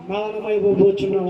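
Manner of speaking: a low, solemn prophet's doom; lightly voiced prophet of doom; grave prophecy. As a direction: pronounce the death sentence gravely. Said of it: "ఏ పలికడో తెలుసా?".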